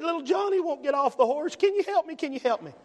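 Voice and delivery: pleading in a high tearful imitation; hammed-up distressed plea, easing down at the end; mock crying. Mother begging help with little Johnny, mock crying. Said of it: "and she's crying".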